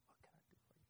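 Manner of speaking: hushed, acted waitress's offer; very soft, whispered offer of service; gentle servile whisper. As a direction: whisper it like an attentive server. Voicing a gentle, eager waitress.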